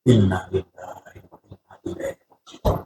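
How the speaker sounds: a low, soft, grieving inna lillahi; hushed solemn recitation. Acted. The Prophet mourning a companion, voiced low and hushed. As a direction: murmur it low like quiet mourning.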